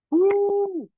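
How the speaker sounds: very high, loud whoop; excited whoop. Real, not acted. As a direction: let out a big workout whoop. Heavy dumbbell exertion cheer.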